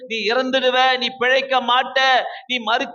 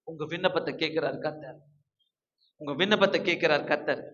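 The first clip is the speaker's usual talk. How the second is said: low, soft comforting words; gently assuring the congregation; soft reassurance; 7 semitones lower, 8 dB quieter than usual. Acted. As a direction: lower your voice and reassure.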